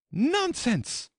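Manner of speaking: very high, loud retort; emphatic, high-pitched Alice; loud and decided. A narrator's reading. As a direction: cut the queen off loudly and decidedly. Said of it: "very loudly and decidedly".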